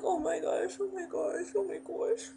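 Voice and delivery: high, small, repeated fretting; panicked reaction. Retold or imitated. Girl watching her friend get caught, high helpless panic. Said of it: "I was just like".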